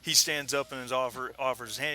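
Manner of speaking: normal talking voice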